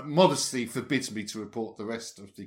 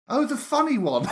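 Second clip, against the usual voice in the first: laughing through the quote; 11 semitones higher, 5 dB louder than usual. Retold or imitated. Retelling fan's praise, laughing.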